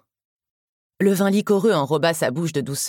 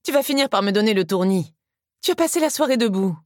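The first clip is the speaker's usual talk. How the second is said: lightly voiced scolding, pitched up; higher voice of affectionate exasperation; fussing relative; 5 semitones higher than usual. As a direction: gently nag a loved one to sit.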